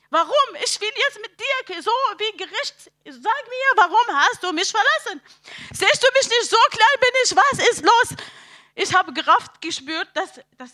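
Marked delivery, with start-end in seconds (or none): 0.1-8.2 high-pitched, pleading demands; desperate reproach. Retold or imitated. Retelling how she challenged God for abandoning her, desperate reproach. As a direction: confront God in anguish, voice lifted.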